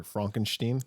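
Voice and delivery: Frankenstein with "fronk" and a final "shteen". Acted. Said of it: "It's pronounced Frankenstein".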